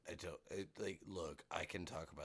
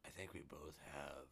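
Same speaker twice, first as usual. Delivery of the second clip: gravelly voice; 3 semitones lower, 7 dB quieter than usual. Shown as a demonstration. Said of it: "really good gravelly voice".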